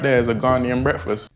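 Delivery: usual speaking voice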